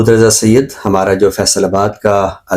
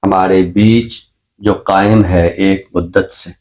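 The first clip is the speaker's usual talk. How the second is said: slow, measured poetry recital; ghazal recitation. Acted. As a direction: savour each line of the couplet. Recites his ghazal on air. (غزل کا ٹھہرا ہوا ترنم سے پاک پڑھنا)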